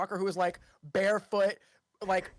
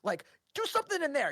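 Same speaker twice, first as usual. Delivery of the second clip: ordinary delivery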